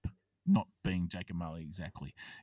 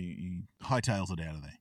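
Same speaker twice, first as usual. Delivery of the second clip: ordinary talk, nothing marked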